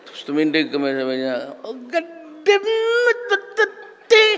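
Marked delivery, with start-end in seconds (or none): 1.6-4.2 much higher, louder, slow shrill scolding; shrill angry professor. Retold or imitated. Professor scolding a student who stood up, shrill angry yell. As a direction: scold like a cranky old law professor. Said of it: "ang boses nito... shrill".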